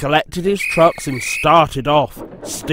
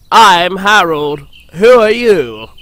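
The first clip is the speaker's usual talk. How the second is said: helicopter character voice; 4 semitones higher, 7 dB louder, slower than usual. A narrator's reading. Helicopter answers Percy.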